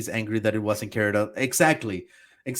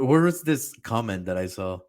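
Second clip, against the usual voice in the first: ordinary talk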